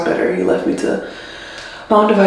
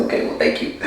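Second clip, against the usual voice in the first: weepy whispered thanks; 10 dB quieter than usual. Retold or imitated. Teary thank-you to husband, retold.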